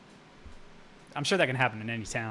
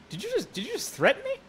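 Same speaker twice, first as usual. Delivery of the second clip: very high squeaky incredulous voice; mock disbelief. Himself to the gunman, squeaky high disbelief. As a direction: squeak it out in shock. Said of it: "I'm like".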